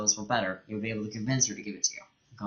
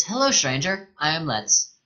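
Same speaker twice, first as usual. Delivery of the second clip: put-on higher, childlike girl voice; girl's bright hello, pitched up; curious young girl; 6 semitones higher, 4 dB louder than usual. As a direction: greet the stranger like a curious little girl.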